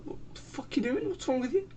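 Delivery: high-pitched startled yelp, imitating himself; shocked outburst. Freaked out by girl with shampoo.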